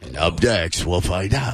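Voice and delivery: low ominous true-crime host; Keith Morrison. Retold or imitated. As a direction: drop low and slow the suspense. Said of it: "like the scary".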